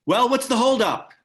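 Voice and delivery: loud, high, impatient call. Acted. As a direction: hurry them up, loudly. Impatient shout.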